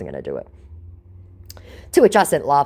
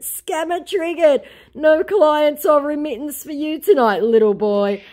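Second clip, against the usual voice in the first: gloating taunt; 12 semitones higher, 4 dB louder than usual. Retold or imitated. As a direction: rub his defeat in. Her mocking victory.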